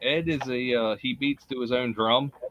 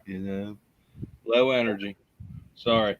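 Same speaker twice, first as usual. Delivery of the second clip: ordinary talk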